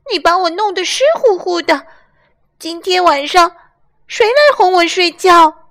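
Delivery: shrill upset little lamb; high lamb voice. Acted. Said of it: "雷尼大声嚷嚷".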